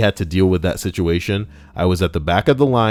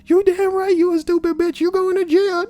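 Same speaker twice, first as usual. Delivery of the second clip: hammed-up high scolding voice; shrieking accuser. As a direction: screech the verdict in a put-on voice. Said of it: "acted quarrel, accuser".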